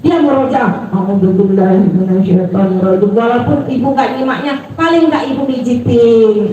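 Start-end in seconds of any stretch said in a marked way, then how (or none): 0.9-3.1 Quran recitation. Retold or imitated. Child reciting Quran at night.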